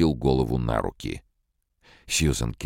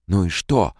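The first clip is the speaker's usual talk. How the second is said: high, loud outburst; exclaiming; 7 semitones higher, 6 dB louder than usual. A narrator's reading. Calvin crying out at Lanning's defence. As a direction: cry it out at him. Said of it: "вскричала Сьюзен Келвин".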